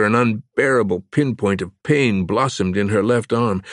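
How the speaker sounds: plain reading voice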